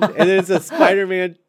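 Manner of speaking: reading through laughter, loud; giggling mid-sentence; laughing. Real, not acted. Reading the funny script aloud, laughing and loud.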